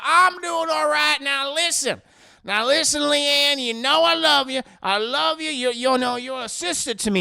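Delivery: put-on drunk caller. Drunk Bobby Joe phones Leanne to say he loves her, loud and tipsy. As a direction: play a tipsy man pushing sentiment at full volume. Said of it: "I'm about five cold ones deep".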